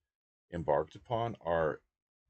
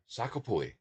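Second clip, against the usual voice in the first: Chinese accent; 5 semitones higher than usual. Shown as a demonstration. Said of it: "the Chinese, uh, term for".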